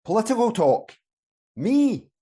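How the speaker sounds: high-pitched, affronted questioning; indignant disbelief. Acted. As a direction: pitch jumps up as if wrongly accused. Accused of political talk, feigns innocence.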